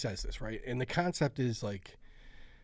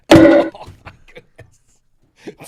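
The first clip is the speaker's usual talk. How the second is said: shocked laugh; 20 dB louder, much slower than usual. Real, not acted. Dodgeball nearly hits him.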